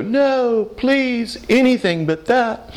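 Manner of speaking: hammed-up sarcastic plea; mock pleading. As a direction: pretend to beg in mock horror. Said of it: "And I wanna go".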